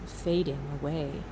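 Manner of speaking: ordinary delivery, nothing marked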